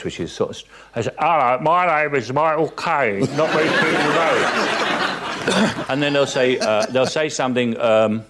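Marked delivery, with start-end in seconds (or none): 1.2-5.8 higher, laughing send-up of his own voice; hammed-up Caine impression; mock Michael Caine. Mocking how impressionists do him. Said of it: "they always do this very strange voice".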